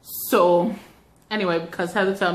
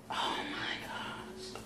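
breathless whispered wonder; awed whisper; 19 dB quieter, slower than usual. Real, not acted. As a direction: whisper it in awe. Awed by the coat.

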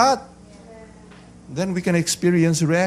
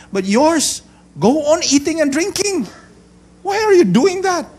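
high, raised accusing question; acted indignant challenge; 12 semitones higher, 6 dB louder than usual. Critics question Jesus about his disciples, indignant challenge. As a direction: challenge him in mock indignation.

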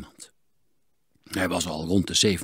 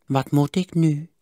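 put-on miserly old voice, a bit higher; stingy old man; 6 semitones higher, 5 dB louder than usual. A narrator's reading. Miser haggles with the smith, stingy old voice. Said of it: "vroeg Sigertsma".

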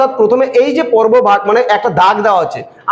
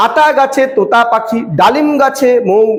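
slow beats, "আতা গাছে", "তোতা পাখি", "ডালিম গাছে", "মৌ"; measured rhyme recital with "ডালিম গাছে" held together; rhythmic verse reading; 3 semitones higher than usual. Shown as a demonstration. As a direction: chant it in joined pairs, leaving "মৌ" on its own. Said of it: "ডালিম গাছে একসাথে বলছি".

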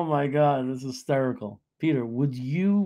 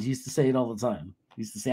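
usual speaking voice

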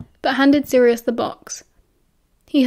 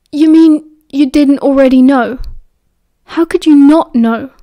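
louder, stunned disbelief; disbelieving; 2 semitones higher, 8 dB louder than usual. A narrator's reading. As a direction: blurt it out, thrown off guard. Caught entirely off guard.

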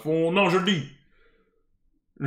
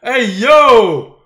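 shouting; 2 semitones higher, 8 dB louder, much slower than usual. Real, not acted. Friends shouting at the screen.

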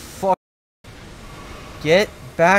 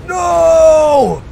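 high, loud cry of no; anguished shout; 14 semitones higher, 10 dB louder than usual. Real, not acted. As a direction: yell in dismay. Near death in fight.

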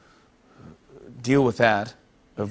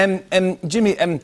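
higher-pitched Northern Irish hesitations; Northern Irish accent; 5 semitones higher than usual. Shown as a demonstration. Host shows off for guest, Northern Irish accent. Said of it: "That's Northern Irish".

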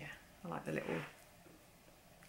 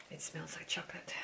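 soft secret whisper; whispering over a palette that smells of chocolate; 10 dB quieter than usual. Real, not acted. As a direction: breathe it like a guilty pleasure. Sniffing the palette.

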